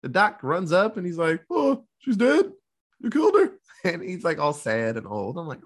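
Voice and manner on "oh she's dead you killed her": mock sad old doc. Old doc mourning the heroine.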